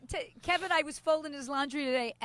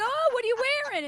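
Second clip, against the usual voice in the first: mock surprise; 9 semitones higher, 4 dB louder, faster than usual. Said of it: "I go".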